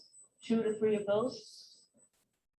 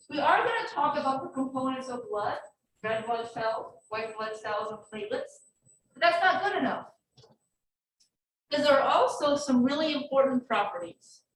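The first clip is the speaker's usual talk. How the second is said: normal talking voice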